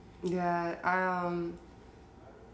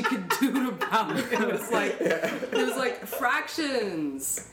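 laughing; 6 semitones higher, 4 dB louder, slower than usual. Real, not acted. Laughing at boring class memory.

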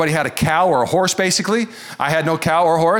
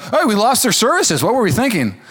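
mock shrug; 7 semitones higher than usual. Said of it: "We're like".

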